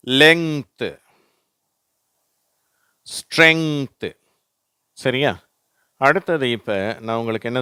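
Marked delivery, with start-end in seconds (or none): 0.1-4.2 லெங்த் and ஸ்ட்ரெங்த் with the ngth ending, said loud; teacher modelling the ngth words; model words length, strength. Shown as a demonstration. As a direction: say ஸ்ட்ரெங்த் slowly. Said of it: "வார்த்தைகளை பார்க்கலாம்".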